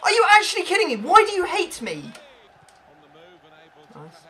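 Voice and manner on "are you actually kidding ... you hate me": incredulous outburst. Real, not acted. Opponent goal, incredulous outburst.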